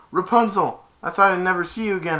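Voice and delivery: loud, high, fast reunion line; hammed-up joyful rush; relieved rush. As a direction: gush with relief. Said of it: "and he said".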